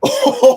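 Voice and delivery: loud, high burst of laughter. Real, not acted. Man reacts to a point about understanding, loud laugh.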